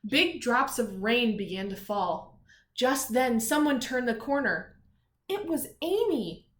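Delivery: storybook reading with energy; story narration. A narrator's reading. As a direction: read it out for the kids. Reads story to children.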